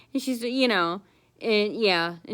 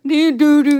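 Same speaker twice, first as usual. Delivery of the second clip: imitated drum banging; 7 semitones higher, 11 dB louder than usual. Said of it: "they always beat the drum".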